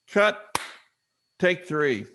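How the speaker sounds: loud, high-pitched director's call; background director. Retold or imitated. Director calling another retake, high loud call. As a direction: break in and call the next take. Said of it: "you hear a person in the background".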